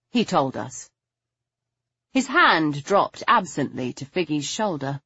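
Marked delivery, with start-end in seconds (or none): none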